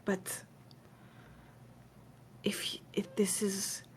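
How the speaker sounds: soft, halting, fading toward a whisper; hushed, uneasy admission; hesitant Fawn confiding. Acted. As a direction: confide it cautiously, with long pauses. Game master voices Fawn hesitantly confiding.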